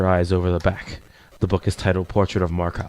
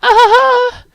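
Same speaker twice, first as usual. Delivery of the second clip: loud, very high falsetto giggle; squeaky character laugh; girlish giggle; 10 dB louder than usual. Acted. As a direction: giggle suddenly as a shy girl. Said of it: "Yuri gently giggles all of a sudden".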